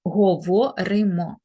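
"Говоримо" slowly split as го-во-ри-мо; syllable-by-syllable dictation. Shown as a demonstration. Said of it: "ми ставимо букву «и»".

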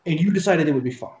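loud, griping accusation; complaining soldier. Acted. Soldier blames his companions, loud and griping. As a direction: blame them loudly. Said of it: "the male voice ... complaining".

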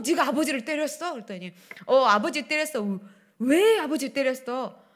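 ordinary delivery

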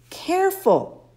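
a high, voiced-up satyr boy; Grover's voice. A narrator's reading. Satyr friend cautions the weak boy, high Grover voice. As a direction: warn him gently in a high boyish voice. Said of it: "a familiar voice said".

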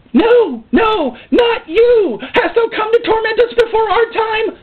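high, wailing scream of protest; hammed-up demonic shriek. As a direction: cry out like a tormented demon. Acts out demon confronting Jesus.

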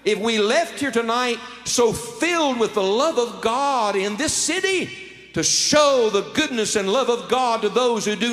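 building preacher's call; rousing sermon climax; preaching. Acted. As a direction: lift the crowd toward the vision.

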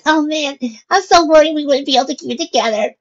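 put-on very high, loud, gleeful kid's voice; gleeful girl. A narrator's reading. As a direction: gush in a high girl's voice after the prank. Girl breaking up after prank.

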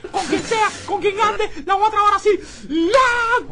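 very loud, high ranting; enraged threats. Acted. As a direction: rant as if about to charge off. Amenaza furioso mientras lo calman. (amenazas a gritos, furioso)

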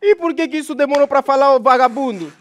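loud, high-pitched scolding; exasperated yell. Real, not acted. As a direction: fire back impatiently, loud and high. Snaps at the slow questioner.

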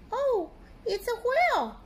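high, loud mock fright; yikes. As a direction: squeal at the giant whale. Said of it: "said Pete".

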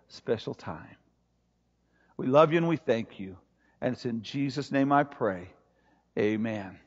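low, hushed pastoral prayer; earnest prayer. Real, not acted. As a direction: pray softly and low, letting it sink toward the amen. Leads congregation in prayer.

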